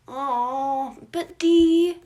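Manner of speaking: put-on loud, high protesting boy; whining kid. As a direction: whine like a disappointed child. Remus protests loudly.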